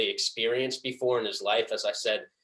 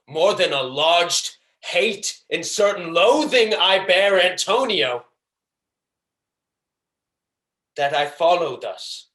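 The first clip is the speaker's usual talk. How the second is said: Shylock; 6 semitones higher, 4 dB louder than usual. Acted. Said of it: "hatred and pleasure that I tried to deliver this monologue".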